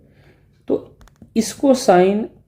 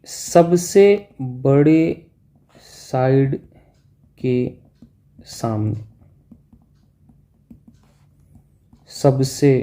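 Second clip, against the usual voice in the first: every word spaced out with long pauses, सबसे stressed and rising; emphatic rule; much slower than usual. Shown as a demonstration. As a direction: drill the rule in slowly, word by word. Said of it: "एक property कभी मत भूलना". (हर शब्द पर ज़ोर देकर धीरे-धीरे)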